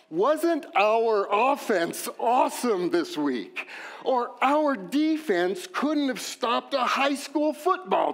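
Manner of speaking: mock sports-fan gushing, slow and drawn out; lightly voiced proud fan; fan talk. Pastor mimicking fans talking about their team. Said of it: "We start talking like this".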